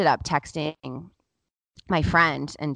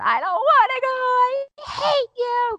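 imitated wailing; 5 dB louder than usual. Son refusing to go to the park, mock wailing. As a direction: wail it like a tantruming little boy. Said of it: "he's just wailing so hard".

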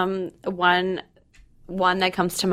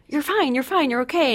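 dismissive reassurance; 9 semitones higher than usual. Retold or imitated. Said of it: "I'm like".

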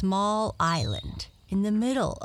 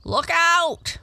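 high, loud sudden cry; alarmed warning; 11 semitones higher, 8 dB louder than usual. Acted. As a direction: call out urgently to warn her. Frog warns of drowning.

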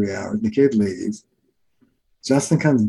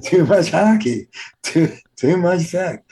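laughing; 6 semitones higher than usual. Real, not acted. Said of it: "punchline repeated".

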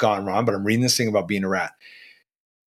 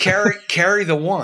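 mock accountant lecturing; 9 semitones higher than usual. Mock accountant lecturing bad bookkeepers.

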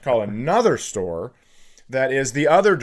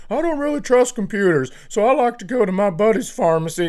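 high, fussy put-on customer voice; mocking a fussy old customer; 7 semitones higher, faster than usual. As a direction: play a fussy customer who distrusts computers, up high. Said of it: "He goes".

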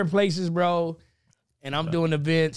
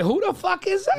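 much higher, baffled question; mock confusion; 13 semitones higher than usual. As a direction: play the whole crowd being lost. Voicing everybody's confusion.

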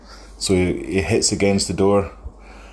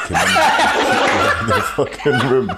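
laughing through punchline; 6 semitones higher, 4 dB louder than usual. Real, not acted.

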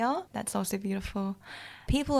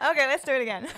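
louder, higher, through real laughter; laughing; 5 semitones higher, 5 dB louder, much faster than usual. Real, not acted. Asking to redo the take after a slip, laughing. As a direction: try to restart while cracking up. Cracking up over her slip.

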